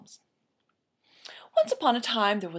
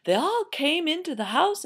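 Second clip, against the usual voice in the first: ordinary delivery, nothing marked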